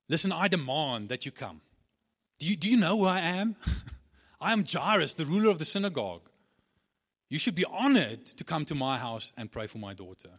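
loud, haughty, self-important insistence; arrogant demand. Pretend. Proud synagogue ruler demands Jesus come, loudly pompous. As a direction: puff yourself up and demand.

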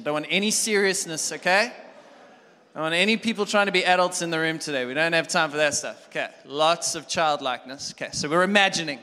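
loud, high, pumped-up exhortation; energetic preaching. Real, not acted. Pastor pumping up the congregation, loud and energetic. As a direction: fire the room up.

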